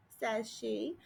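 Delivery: usual speaking voice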